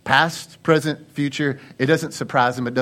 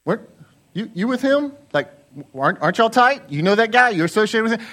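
higher-pitched, halting questions; nosy questioners; 7 semitones higher than usual. Acted. People coming up to Peter during the trial, questioning. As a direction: probe with rising, suspicious questions. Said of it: "people come up to him and they're like".

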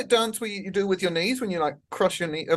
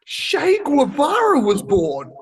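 slow, higher and louder reveal; dramatic reveal; 7 semitones higher, 5 dB louder, much slower than usual. Acted. Reveals the same-day fact to friends, slow and dramatic. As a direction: draw out the name for the big reveal.

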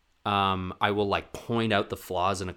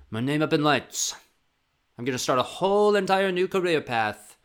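rising, higher, bigger with hype; grand excitement; 6 semitones higher, 4 dB louder than usual. Retold or imitated. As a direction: announce your grand dream. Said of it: "And I was like".

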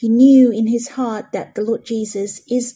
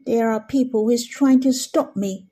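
ordinary talk

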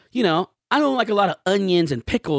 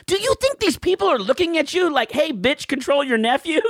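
higher, incredulous mock retort; mocking disbelief; 8 semitones higher than usual. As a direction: scoff at how absurd she's being. Mocking imagined staff's thoughts.

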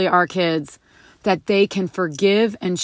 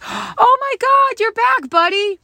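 gushing teacher; 14 semitones higher than usual. Retold or imitated. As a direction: coo over the boy like a thrilled teacher. And she goes.

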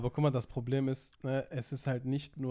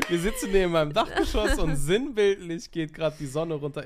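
speaking while laughing, louder; amused, laughing through words; 6 semitones higher, 6 dB louder than usual. Real, not acted. Laughing over sunset symbolism.

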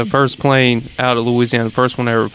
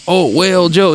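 higher, imitation country accent; put-on country twang, pitched up; mock country accent; 6 semitones higher than usual. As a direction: imitate outsiders putting on country talk. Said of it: "they make their country".

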